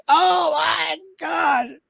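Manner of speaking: very high, slow, stretched shriek; freaked out. Retold or imitated. Imitating the boyfriend seeing the fridge photo, a drawn-out high shriek. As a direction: imitate his shocked scream. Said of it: "He's like".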